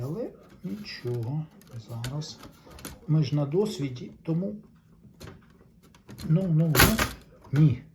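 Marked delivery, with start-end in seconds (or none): 6.3-7.8 loud, exasperated "no, no, no"; frustrated outburst. Real, not acted. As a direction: blurt it out as the part slips. Struggling to remove panel, exclaims. (роздратований вигук)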